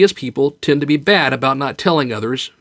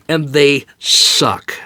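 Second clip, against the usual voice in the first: slow, breathy whisper of an admission; slow whispered aside; much slower than usual. Real, not acted. Admitting pain hurts.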